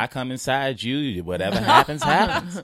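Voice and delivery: goofy, laughing dad impression; mock dad voice. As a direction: ham up a carefree father. Voicing his parents having sex.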